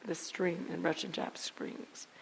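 slow hushed whisper. Acted. Preacher naming the stream to the congregation, slowly whispered. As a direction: let the place name fall to a whisper. Dramatic reveal in testimony.

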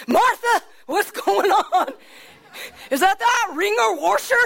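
panicked yelp to Martha; a farmer startled by his shaking house calls out. Acted. Obed Edom startled by shaking house.

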